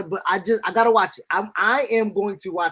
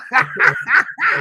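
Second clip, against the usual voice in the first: loud laugh shout; 6 semitones higher, 6 dB louder than usual. Real, not acted. Excited outburst over wrestler.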